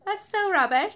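mild mock of her old self; imitating her younger self. Laughing at her old video, a light mock "that's so rubbish". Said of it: "I love the way I said that".

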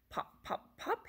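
short popped words; cork popping. A narrator's reading. Reader imitates a cork for children, popping. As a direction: pop each word like a cork. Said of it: "He can sound like a cork".

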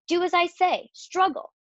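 high-pitched, insistent orders; pressing storybook command; urgent command. A narrator's reading.

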